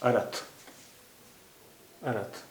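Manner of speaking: "अर्थ" said a-rath with a vowel between र and थ; "अर्थ" opened to arath; wrong full-ra reading. Shown as a demonstration. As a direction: break "अर्थ" into two syllables, arath. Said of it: "पूरा रा होता".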